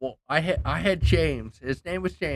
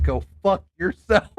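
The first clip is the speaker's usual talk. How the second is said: angry shout; 6 semitones higher, 6 dB louder than usual. Retold or imitated. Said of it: "I'm like".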